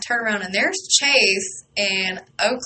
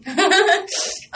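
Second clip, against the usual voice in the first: laugh; 10 semitones higher, 4 dB louder than usual. Real, not acted. Laugh after excited squeal.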